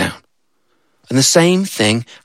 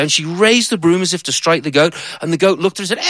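ordinary delivery

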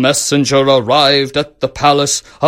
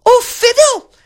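even higher, loud mimicking squeak; echoing ladies-in-waiting; 5 dB louder than usual. A narrator's reading. Ladies-in-waiting copy the princess, shrieky high echo. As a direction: parrot the princess at the very top. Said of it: "echoed the ladies-in-waiting".

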